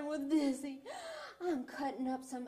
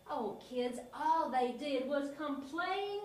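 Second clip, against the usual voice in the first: usual speaking voice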